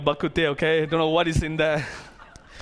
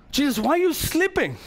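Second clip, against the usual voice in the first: mildly exasperated question, pitched higher; irritated disciples; 7 semitones higher than usual. Retold or imitated. Disciples to a sleeping Jesus in the storm, mildly irritated. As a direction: imitate disciples complaining, voice lifted. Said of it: "they go so irritated with Jesus".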